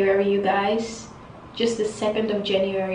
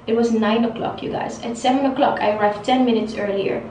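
ordinary delivery

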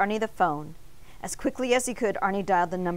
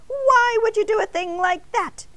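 high, loud indignant protest; scandalized high-pitched rant; shrieking outrage; 14 semitones higher, 6 dB louder than usual. A narrator's reading. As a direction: tell him off, outraged, pitch way up. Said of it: "Arnie shrieked".

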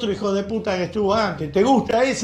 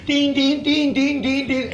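high, ringing tin tin like spoons on pots; mock pot banging; 8 semitones higher, slower than usual. Radio host mocking the cacerolazo, high pot-banging chant. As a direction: chime it out like a pan being struck.

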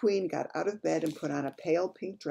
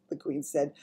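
usual speaking voice

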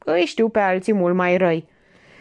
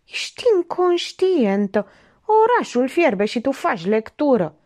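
he can't believe she's reading; husband aghast at his calm wife; stunned outburst; 6 semitones higher than usual. A narrator's reading. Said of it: "Nu-și putea ascunde stupoarea".

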